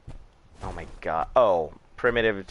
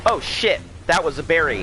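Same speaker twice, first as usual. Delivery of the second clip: sudden high yelp of surprise; startled shout; 7 semitones higher, 3 dB louder than usual. Real, not acted. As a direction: jump and yell in shock. Startled by a dino attack.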